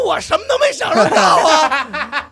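high, loud, slow whining rant; mock complaint. Dog rants in the living room, whining high and loud. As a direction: wail it like a cheated dog. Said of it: "又骂街".

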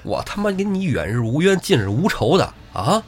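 宋江破口大骂黄文炳; mock tirade. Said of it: "宋江上来破口大骂".

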